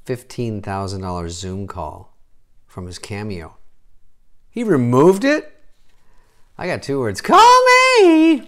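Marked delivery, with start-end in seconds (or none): 4.5-5.6 high, loud question swooping upward; incredulous. Acted. Comic reacts to the withdrawn Zoom offer, incredulous. Mock disbelief at dropped paid option. 7.2-8.4 very high, loud, stretched-out holler; eager shout. Acted. Comic touts himself for the pricey call, eager shout. Shouts offer to would-be paying customer.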